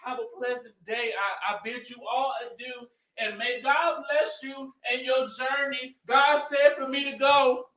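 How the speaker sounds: hammed-up pious goodbye to the room; mimicked Melody. Acting out Melody's pious grand farewell.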